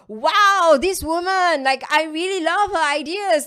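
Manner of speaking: loud, high "wow" of admiration; imitated fan enthusiasm, easing off; admiring fan. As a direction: gush like an impressed reader. Imagined readers admire her post.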